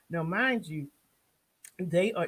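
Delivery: ordinary talk, nothing marked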